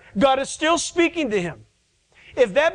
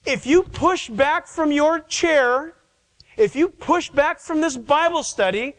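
higher, slow build, leaning on "you"; rising challenge; 5 semitones higher than usual. Real, not acted. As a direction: keep pressing "you" as a warning, each line climbing. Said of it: "preaching challenge".

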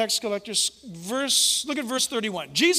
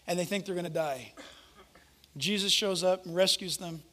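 ordinary talk

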